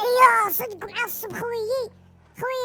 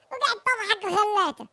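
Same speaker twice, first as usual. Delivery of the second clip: ordinary talk, nothing marked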